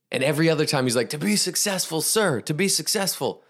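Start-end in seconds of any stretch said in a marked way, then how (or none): 1.1-3.4 pleading answer. Acted. Student answers the demanding guru.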